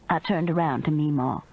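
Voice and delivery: plain reading voice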